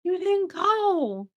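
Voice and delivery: hammed-up disappointed teacher; imitating her teacher. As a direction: play the let-down teacher, pitch way up. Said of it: "She was like".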